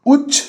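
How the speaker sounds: উচ্চ cut off short on the stopped ch sound; syllable demo. Shown as a demonstration. Teacher sounding out উচ্চ with a clipped closed ending. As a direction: say উচ্চ so the air stops dead at the end. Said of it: "উচ্চারণটা কি continue হচ্ছে".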